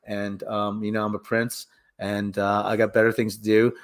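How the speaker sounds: low, monotone mumbled complaint; reluctant prince, low and droning; Flash Thompson. Acted. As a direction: grumble along in a low, soft monotone. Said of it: "Flash Thompson's like".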